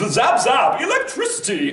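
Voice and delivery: put-on high, bright, zappy anime boy; laughing through a zippy boy voice; pretty boy voice. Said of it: "This pretty boy would have".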